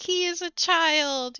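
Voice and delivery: loud, high, drawn-out accusation; mock quarrel. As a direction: accuse loudly, as if in an argument. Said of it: "if you're fighting with someone".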